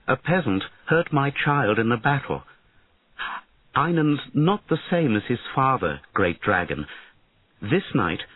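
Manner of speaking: a worried mother-queen begging for help; pleading queen. Acted.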